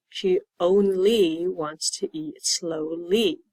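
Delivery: slowly read, every word clear; slow model sentence. Shown as a demonstration. Teacher reads sentence slowly for the Y sound. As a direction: read it slowly so pupils catch the ending. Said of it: "in this sentence".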